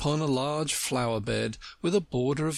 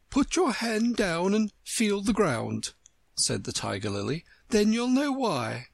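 lofty and superior; haughty flower; 9 semitones higher than usual. Acted. Tiger Lily voiced.

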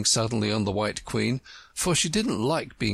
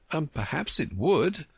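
hesitant, higher-pitched reply; cautious; 7 semitones higher than usual. A narrator's reading. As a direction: concede it carefully as young Alice. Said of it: "Alice replied cautiously".

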